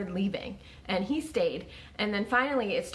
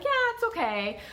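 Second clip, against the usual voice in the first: much higher, breezy forced cheer; fake upbeat reply; 13 semitones higher than usual. Telling acquaintances she is fine with pharmacy, fake cheer. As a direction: brush off the question with false brightness. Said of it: "I'd be like".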